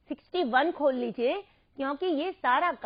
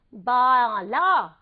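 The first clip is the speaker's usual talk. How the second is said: slow, louder Bala; stretched model word; 2 semitones lower, 6 dB louder, much slower than usual. Shown as a demonstration. Teacher presenting a new word, slow and loud. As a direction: draw out Bala for the class. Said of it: "ये है".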